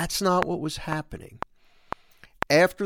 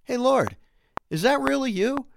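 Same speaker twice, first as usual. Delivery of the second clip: much higher, searching Peter voice; Peter asking, unsure; 11 semitones higher than usual. Acted. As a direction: call out uncertainly, high and questioning. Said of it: "somebody trying to discern".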